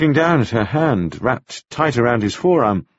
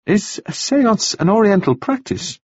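doubtful; 10 semitones higher than usual. A narrator's reading. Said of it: "Anna said doubtfully".

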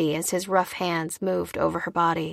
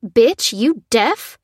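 sharp, raised, louder snap; irritated, higher-pitched challenge; snapping; 4 semitones higher, 6 dB louder, much slower than usual. A narrator's reading. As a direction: snap it as an impatient insult. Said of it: "snapped the lady".

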